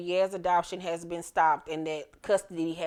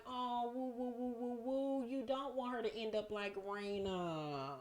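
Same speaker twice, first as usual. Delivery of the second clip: soft, higher teasing hoots of mock shock; mock-shocked woo; 5 semitones higher, 11 dB quieter than usual. A recapper reacting to Effie's words with soft high mock-shocked hoots. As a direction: coo it up high, softly, like you can't believe she said it.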